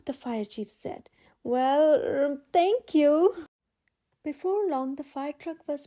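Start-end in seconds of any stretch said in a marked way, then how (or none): 1.4-3.4 louder, slow halting thanks; flustered. A narrator's reading. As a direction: stammer out the thanks, caught off guard. Flustered fire chief.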